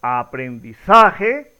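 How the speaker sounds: "aprendizaje" slowed, with ZA stressed, rising as if asking; slow "aprendiZAje" with questioning tune. Shown as a demonstration. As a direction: lean on ZA in "aprendizaje", offered as an option. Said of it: "La pronunciación correcta sería".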